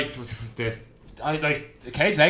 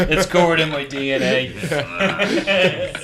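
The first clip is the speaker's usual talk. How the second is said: laughing tease; much slower than usual. Retold or imitated. Teasing quote continues.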